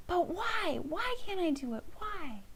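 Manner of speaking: put-on a child nagging mom over the ball, high and insistent; whiny child. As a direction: whine like a kid begging mom for the ball.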